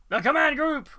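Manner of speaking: high, loud, laughing; excited laughing shout. Real, not acted. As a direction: shout it with joy. Announcing reveal, laughing.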